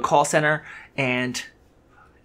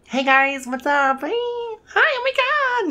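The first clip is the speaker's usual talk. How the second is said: much higher, exaggerated girly falsetto greeting; overdone falsetto voice; slower than usual. Shown as a demonstration. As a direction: squeeze it way up into a hammed-up high girly voice. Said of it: "when you hear, like".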